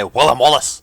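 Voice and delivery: Scottish accent, Willem with a short e; Scottish demo of Willem Wallace. Shown as a demonstration. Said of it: "You have to say".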